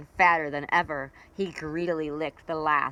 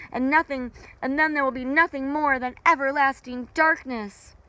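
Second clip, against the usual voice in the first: a higher, anxious creature voice; worried night creatures; 7 semitones higher than usual. Acted. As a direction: plead about the vanishing moon.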